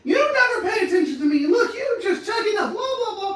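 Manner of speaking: high, loud accusing tirade; shrieky mock complaint; whining nagging partner. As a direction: rant like an aggrieved partner, hammed-up.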